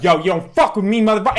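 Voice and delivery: retold angry yell; angry threat. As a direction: bark it like a furious gangster. [name] threatening man in story.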